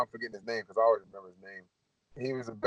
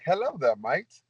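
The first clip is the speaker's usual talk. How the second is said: high, put-on British greeting; British accent; 5 semitones higher, slower than usual. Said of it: "I got a British accent".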